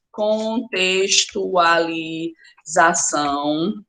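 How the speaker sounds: very slow, low, syllables drawn out; stretched out syllable by syllable, low-pitched; writing aloud. Real, not acted. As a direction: say it slowly as you write it down. Dictates word while writing it.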